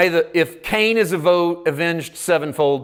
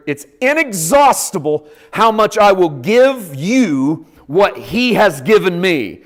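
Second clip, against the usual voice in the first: usual speaking voice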